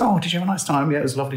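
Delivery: high chirpy retelling of the car chat; dad and daughter chatting in the car, high light voicing; high mock chat. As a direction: voice both sides lightly and brightly.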